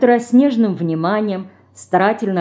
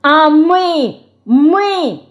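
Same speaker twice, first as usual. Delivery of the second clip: put-on high, loud, outraged girl's cry; furious shout; 9 semitones higher, 6 dB louder, much slower than usual. A narrator's reading. Dinka screams at her sister, furious. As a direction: yell it in a childish rage. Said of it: "в бешенстве закричала Динка".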